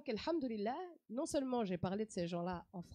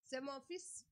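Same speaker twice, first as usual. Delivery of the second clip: knowing mama; 2 semitones higher, 9 dB quieter, much slower than usual. Retold or imitated. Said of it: "Elle me dit".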